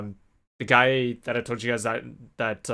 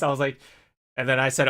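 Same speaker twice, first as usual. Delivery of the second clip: usual speaking voice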